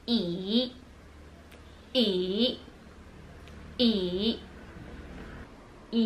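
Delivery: E dipping low then rising, third tone. Shown as a demonstration. Teacher demonstrating the low dipping third tone. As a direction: sink E down and bring it back up. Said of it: "Third tone is a dipping tone".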